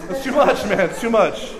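amused half-laughing protest; laughing whoa. Retold or imitated. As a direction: back off laughing, too much. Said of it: "I'm like".

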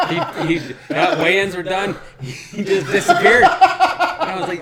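loud, high laughter through the words; can barely get it out for laughing; laughing hard. Real, not acted. Co-host cracking up about the fighter who vanished.

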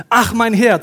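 loud, high cry of despair; desperate cry. Acted. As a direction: call out to your master in panic. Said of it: "rief er". (verzweifelter Ausruf, laut und hoch)

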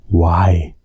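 ordinary talk, nothing marked

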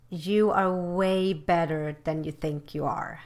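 usual speaking voice

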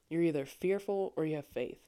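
normal talking voice

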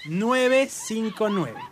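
very slow, spaced-out digits; slowly. Shown as a demonstration. Host finishing the phone number for note-takers, slowly. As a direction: dictate each digit group with room to write. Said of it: "Ahí está despacito".

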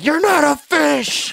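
high, slow, yelled out; screaming. Shown as a demonstration. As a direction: scream it at the kid. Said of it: "Just start screaming at".

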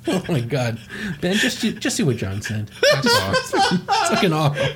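real laughing fit breaking up the words; helpless laughter. Real, not acted. Host reacting to what a friend sent, laughing helplessly. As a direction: crack up and squeeze words through laughter.

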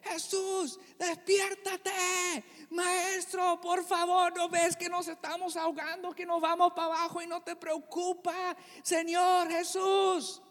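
high, frantic pleading cry to wake him; terrified disciples crying out; panicked shouting disciples. Acted. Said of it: "vienen los discípulos y gritando".

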